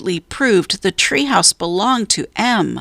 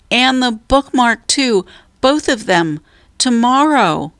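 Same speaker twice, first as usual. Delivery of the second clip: loudly; 6 semitones higher than usual. A narrator's reading. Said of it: "Jack said loudly".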